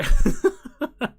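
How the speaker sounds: high, loud cackle; sudden high-pitched laugh; real burst of laughter. Real, not acted. Cracks up at chat.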